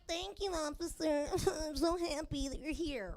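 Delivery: sugary fake-polite voice to the officer; fake-sweet thanks. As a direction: lay on the false gratitude. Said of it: "I was like".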